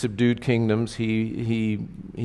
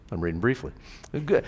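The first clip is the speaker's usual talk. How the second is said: usual speaking voice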